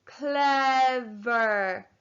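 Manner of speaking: CLEVER drawn out, two long beats; said slowly. Shown as a demonstration. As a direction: stretch each syllable of CLEVER. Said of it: "Say the word slowly".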